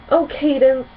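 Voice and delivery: overwhelmed with joy; a young princess gushing with gratitude; delighted cry. Acted. Twilight thrilled by gift.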